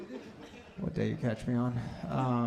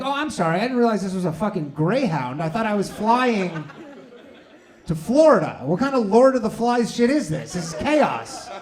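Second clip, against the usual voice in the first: high, loud sarcastic rant; mock rant; 5 semitones higher, 6 dB louder than usual. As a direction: tear into the agent with sarcasm. Ranting at gate agent.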